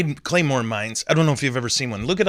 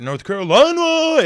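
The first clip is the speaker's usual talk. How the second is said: North Carolina sung out much higher and louder; a booming mock DJ North Carolina; hype DJ call; 14 semitones higher, 7 dB louder than usual. Shown as a demonstration. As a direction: try it as a big radio shout-out. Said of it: "it just doesn't work".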